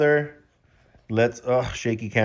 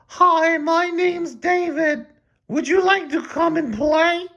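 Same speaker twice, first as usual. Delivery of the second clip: put-on very high squeaky boy voice; high squeaky boy, slow on his name; squeaky David voice; slower than usual. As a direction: squeak it out like a cartoon kid. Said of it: "I think David talks".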